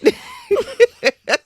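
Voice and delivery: high, laughing through the words; giggly recovery. Real, not acted. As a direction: try to stop giggling and carry on. Laughing, collecting herself.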